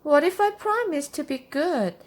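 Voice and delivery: put-on high, hopeful child's voice; pleading little witch. A narrator's reading. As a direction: plead upward to mother as a little girl. Child bargains with mother.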